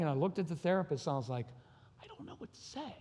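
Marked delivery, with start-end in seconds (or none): 2.0-2.9 tiny high voice, nearly all breath; retold crying. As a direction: choke it out in a faint, tearful squeak. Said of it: "I started crying".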